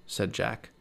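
plain reading voice

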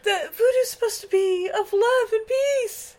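high, whimpering mock plea; hammed-up tearful appeal; Lisa pleading. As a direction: beg in a put-on high woman's voice. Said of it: "she's like".

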